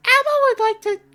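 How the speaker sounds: a high, loud Elmo squeak. Retold or imitated. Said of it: "I couldn't even pick up my Elmo voice".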